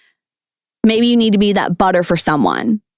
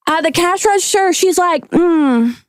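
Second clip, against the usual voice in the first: high-pitched Southern gossip; drawn-out, higher Southern storytelling; Southern accent; 7 semitones higher than usual. Acted. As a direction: dish it out in a put-on Southern voice. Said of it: "talk in a Southern accent, please".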